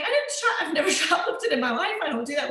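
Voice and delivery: high, startled protest of innocence; shocked denial. Retold or imitated. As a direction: caught off guard, insisting you never stole. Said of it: "And I'm like".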